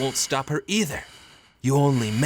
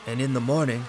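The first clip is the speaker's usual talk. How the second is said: ordinary talk, nothing marked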